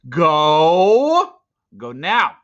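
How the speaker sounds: loud, pushed go; urgent command. Acted. Teo forcing Ram into the river, loud urgent command. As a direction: drive the wolf away.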